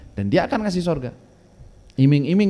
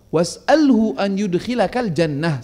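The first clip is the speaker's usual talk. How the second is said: louder, slow, weighty recitation; slow loud Arabic recitation; 4 semitones higher, 4 dB louder, slower than usual. Acted. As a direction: proclaim the maxim slowly.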